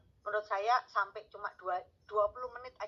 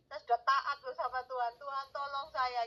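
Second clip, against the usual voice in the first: pitched-up, pleading retelling of her prayer; mock-pleading prayer to God, higher voice; imitating her pleading prayer; 6 semitones higher than usual. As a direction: beg God the way she did then. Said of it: "pokoknya ngomongnya kayak begitu ya".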